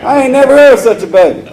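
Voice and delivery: high, loud, half laughing mock rant; laughing mock complainer. Irritated man griping about the baby, high and laughing. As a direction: yell it high while cracking up.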